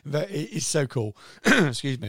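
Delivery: losing her voice, strained and cracking; hoarse voice giving out. Real, not acted. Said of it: "I'm losing my voice".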